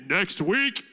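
lowest possible voice; extremely deep bass voice. Shown as a demonstration. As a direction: push the voice down as far as it goes. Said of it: "in the lowest voice you could say".

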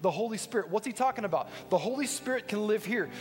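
high-pitched, insistent sermon climax; forceful preaching. Real, not acted. Preacher telling believers the Spirit lives within, insistent preaching. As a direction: drive each point home to the congregation.

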